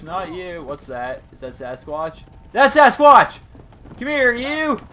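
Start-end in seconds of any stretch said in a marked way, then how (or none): 2.5-4.8 high, loud shout at a sighted target; excited shout. Real, not acted. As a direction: yell out at your quarry. Spots his quarry, calls out.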